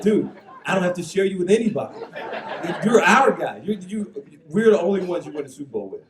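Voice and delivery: high, loud, laughing through the retold reply; delivering the retort while cracking up. Retelling his reply to Carl Nicks, laughing hard. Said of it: "I said".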